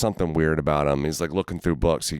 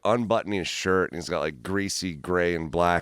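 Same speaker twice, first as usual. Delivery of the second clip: ordinary delivery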